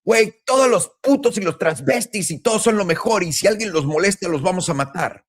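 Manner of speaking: high, put-on voice of a self-righteous crusader; sneering parody of a political line; mocking a preachy activist. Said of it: "toda la retórica".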